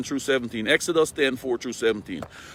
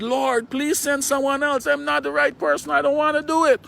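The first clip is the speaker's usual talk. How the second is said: high, pleading voice, getting louder toward the end; pleading Moses; 13 semitones higher, 4 dB louder than usual. Retold or imitated. Preacher voicing Moses begging God in a high pleading voice. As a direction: beg the Lord to send someone else. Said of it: "Moses again pleaded".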